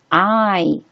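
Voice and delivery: I held long, like eye; letter-name I. Shown as a demonstration. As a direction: draw out I as its alphabet name. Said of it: "在这里发本声音".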